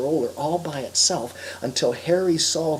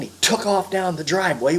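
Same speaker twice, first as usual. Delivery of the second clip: plain reading voice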